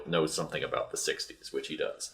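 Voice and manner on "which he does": ordinary talk, nothing marked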